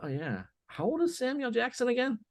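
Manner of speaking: high, amused mock self, suddenly sidetracked; distracted aside. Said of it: "I'm on Google and I go".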